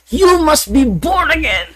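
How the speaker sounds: high, slow pastor proclamation; shouted preaching. Retold or imitated. Pastor shouting at the Adventist neighbors, high and slow. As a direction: call it across the street like a preacher. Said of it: "sigaw din si Pastor Dinoro".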